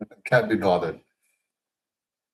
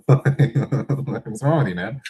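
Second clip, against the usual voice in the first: laughing mock protest; 3 semitones higher, slower than usual. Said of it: "Like, yo".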